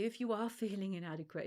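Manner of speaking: ordinary delivery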